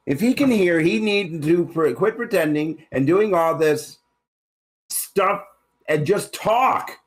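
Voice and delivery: high-pitched exasperated demand. Acted. Acted quarrel, exasperated demand.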